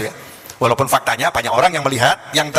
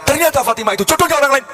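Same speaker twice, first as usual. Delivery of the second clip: mock-alarmed prayer; 9 semitones higher than usual. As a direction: pitch leaps up mid-prayer. Prayer builds to comic alarm.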